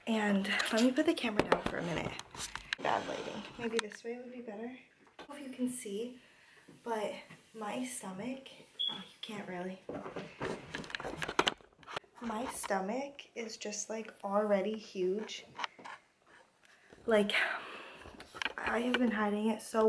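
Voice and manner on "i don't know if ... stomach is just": soft whispered aside. Real, not acted. Mom whispering to the camera about her bump. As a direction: keep it hushed and secretive.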